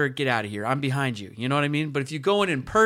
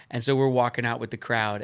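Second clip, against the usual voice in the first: usual speaking voice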